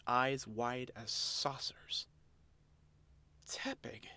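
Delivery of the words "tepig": breathless high whisper of the name; stunned whisper. Acted. Oshawott realizing the girl is a Tepig, shocked whisper.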